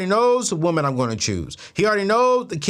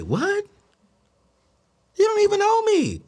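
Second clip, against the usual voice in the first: high-pitched, offended disbelief; incredulous outburst; 14 semitones higher than usual. Retold or imitated. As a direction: act out a squeaky-high indignant protest. Acted argument with date over his sign.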